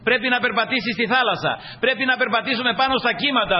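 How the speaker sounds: high-pitched, insistent exhortation; emphatic pulpit delivery with lifted voice; impassioned preaching. Real, not acted. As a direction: urge the congregation onward.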